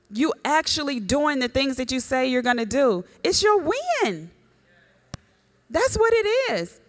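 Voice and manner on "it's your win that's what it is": much higher, slow build of excitement; rising fervor. Real, not acted. Preacher lifting the church on the win, ringing high. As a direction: lift it high like a shout of victory.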